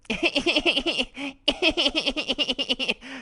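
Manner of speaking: long high rapid giggle; put-on high tittering; character giggle. Second character laughs.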